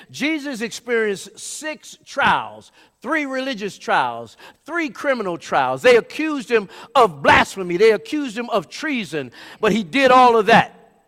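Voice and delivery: preach. Real, not acted. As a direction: hammer out each charge to the congregation.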